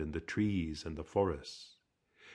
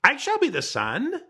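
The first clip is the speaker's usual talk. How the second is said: much higher, louder screeching hawk; proud hawk screeching his claim; shrill screech; 6 dB louder than usual. A narrator's reading. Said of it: "Hawk screeched in his shrill voice".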